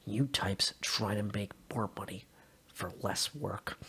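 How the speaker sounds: a calm threat that never needs to shout; menacing officer. A narrator's reading. Officer's quiet menacing speech.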